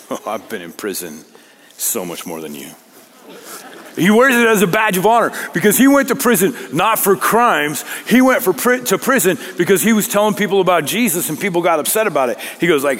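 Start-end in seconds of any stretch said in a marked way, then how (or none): 0.1-2.7 low, soft mock brag; understated boast. Imagined ex-prisoner one-upping others, understated boast. 4.0-12.4 raised, driving high-pitched delivery; impassioned preaching. Real, not acted. Preacher defending Paul's prison record, impassioned preaching.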